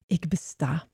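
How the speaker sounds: hushed, whispered thought; thought to oneself. A narrator's reading. Zebedeus thinking to himself on his walk, whispered. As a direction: whisper it as a private realisation. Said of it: "dacht Zebedeus".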